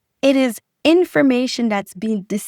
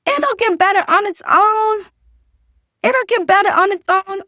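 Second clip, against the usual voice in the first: mocking the lie; 10 semitones higher, 5 dB louder than usual. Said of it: "Lie number six".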